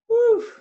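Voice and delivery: a high, loud blown-out whew of relief; relieved whew. Real, not acted. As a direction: let the scare out in one loud breath. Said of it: "I nearly had a heart attack".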